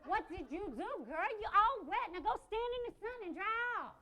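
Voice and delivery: high-pitched mother calling out; scolding a child. Acted. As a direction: scold your kid from across the park. Mother scolding wet child at park.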